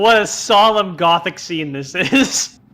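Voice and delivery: slow, loud, grandly mock-solemn. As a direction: announce it like a pompous gothic narrator. Mocking the bloody scene.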